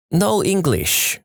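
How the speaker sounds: put-on slow, high broken Chinese accent; halting mock Chinese accent, drawn out. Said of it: "my most broken Chinese accent imitation".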